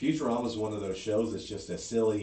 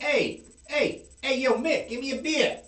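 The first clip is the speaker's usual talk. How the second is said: loud, much higher Boston guy calling for a beer; brash Boston bar shout, pitched way up; Boston accent; 7 dB louder than usual. Retold or imitated. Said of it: "that was my Boston".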